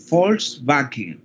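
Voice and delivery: Volkswagen said slowly with an f, "folsbakin"; German pronunciation. Shown as a demonstration. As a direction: Volkswagen starting on f, drawn out. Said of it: "El coche del pueblo".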